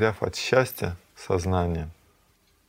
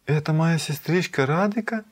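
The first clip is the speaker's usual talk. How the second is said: higher-pitched, amazed question rising at the end; trembling with emotion; 8 semitones higher than usual. A narrator's reading. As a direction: ask it in acted, agitated wonder. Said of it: "дрожа от волнения".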